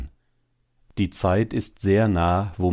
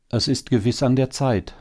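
higher-pitched, lightly sighing assent; mild sigh; 7 semitones higher than usual. A narrator's reading. Hare wife hoping for better times, mildly sighing. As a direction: agree with a touch of a sigh. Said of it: "sagte die Häsin seufzend".

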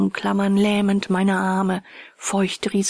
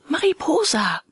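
a higher call into the dark forest; 8 semitones higher than usual. Acted. Narrator calls for Mariposa in the dark clearing, higher.

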